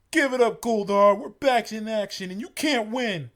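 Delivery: high, loud heroic yell; defiant shout. Acted. Heroes defy Goldar.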